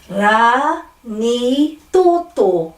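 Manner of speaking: Ra, ni, toto as long separate syllables; slow syllable drill with pure vowels; broken at vowels. Shown as a demonstration. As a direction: stretch each syllable of Ra, ni, toto. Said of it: "breaking up at every vowel".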